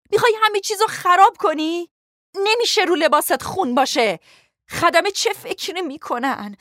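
furious, frantic high-pitched tirade; angry screaming. A narrator's reading. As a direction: berate in a shrieking panic. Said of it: "فریاد زد".

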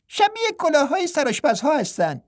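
high, light twin voice finishing his brother's line; a playful twin monk pitched well up. A narrator's reading. (صدای زیر و سبک راهب دوقلو)

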